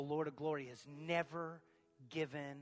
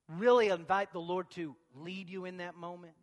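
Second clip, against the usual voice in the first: ordinary delivery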